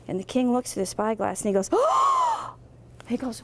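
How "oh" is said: sudden high cry of wonder; amazed gasp. Acted. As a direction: gasp as the hidden view leaps into sight. King sees through magic spyglass.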